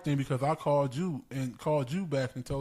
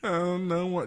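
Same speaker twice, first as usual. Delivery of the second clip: ordinary talk, nothing marked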